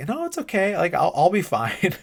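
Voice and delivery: high-pitched mock reassurance; playful high put-on voice; imitated Tully. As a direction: pitch it way up, imitating a character chiming in. Acting out Tully chiming in.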